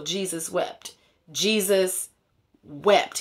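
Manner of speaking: ordinary talk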